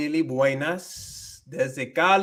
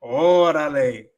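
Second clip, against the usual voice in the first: slow, loud Mexican "Órale"; drawn-out Mexican exclamation; 5 dB louder, slower than usual. Retold or imitated. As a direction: stretch "Órale" out like a Mexican friend. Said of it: "I talk a little bit different too".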